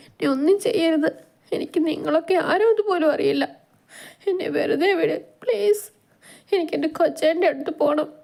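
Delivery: put-on high, frightened girlish plea; tearful begging. A narrator's reading. Captive girl begs her captor to let her go, high and tearful. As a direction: beg for mercy in a high, small voice. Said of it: "തൊഴുതു കരഞ്ഞുകൊണ്ട് പറഞ്ഞു".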